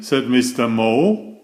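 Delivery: plain reading voice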